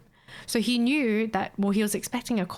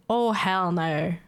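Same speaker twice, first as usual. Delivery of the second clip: hell no; much slower than usual. Real, not acted. Drawn-out refusal.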